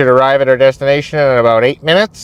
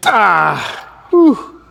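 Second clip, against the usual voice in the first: exhausted gasp and whew; 4 semitones higher than usual. Real, not acted. End of a heavy set.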